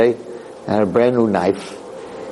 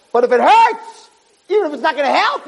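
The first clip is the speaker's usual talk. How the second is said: much higher, climbing impassioned plea; rising preaching fervor. Acted. Rabbi pressing the congregation to feel pain, rising plea. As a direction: build the urgency step by step.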